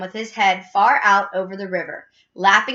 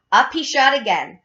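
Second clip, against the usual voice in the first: plain reading voice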